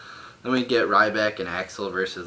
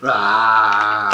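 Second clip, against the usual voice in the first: a sudden loud mock roar; Heyman yell; 11 dB louder than usual. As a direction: bark out a single wild 'Rah!' like a ranting promo guy. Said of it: "He's yelling".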